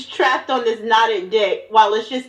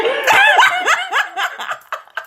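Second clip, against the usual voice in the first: high shrieking laugh; 3 dB louder than usual. Real, not acted. Friend shrieks with laughter at the AO3 joke.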